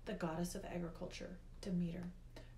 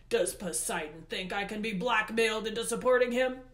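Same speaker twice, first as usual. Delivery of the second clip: loud, imperious tirade; Hades, enraged; 9 dB louder than usual. A narrator's reading. Hades accuses the girl and her companions, enraged. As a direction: berate them with mounting indignation.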